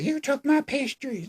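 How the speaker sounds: put-on very high, fast, whiny girl voice; a hammed-up sulky teen dodging; whiny mock Emma. Mocking Emma refusing to talk about Kieran. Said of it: "she's like".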